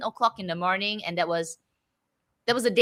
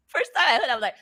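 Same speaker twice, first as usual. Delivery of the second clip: usual speaking voice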